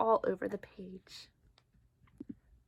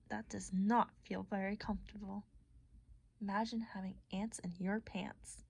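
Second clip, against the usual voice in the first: whisper; 4 semitones lower, 10 dB quieter than usual. Acted. Whispered aside to listeners.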